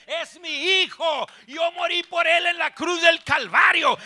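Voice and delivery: high, slow preacher's shout; impassioned command. Acted. Jesus orders the devil to release his child, shouted command. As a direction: build to a full-force, high-pitched cry.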